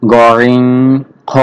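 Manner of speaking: গরিং and খ said thick from the end of the throat; thick throat sounds. Shown as a demonstration. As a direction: say গরিং, খ full and heavy. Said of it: "মোটা করে উচ্চারণ হবে". (মোটা করে গরিং, খ)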